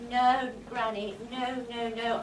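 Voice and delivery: low comic refusal; shrill camp pantomime dame. Acted.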